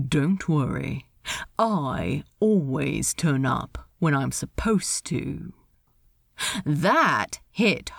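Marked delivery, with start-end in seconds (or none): 0.1-5.5 pent-up anger leaking into a hushed reply; restrained anger. A narrator's reading. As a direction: keep the anger low and contained. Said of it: "allowing some of that pent-up anger to escape".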